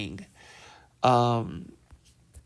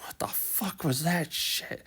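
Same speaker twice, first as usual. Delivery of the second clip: whispering; 3 semitones higher, 8 dB quieter than usual. Retold or imitated. Player hiding, whispering.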